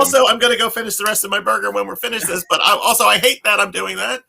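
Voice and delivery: high-pitched, through laughter; amused mock confession. Joking about finishing a burger after agreeing, laughing mock confession.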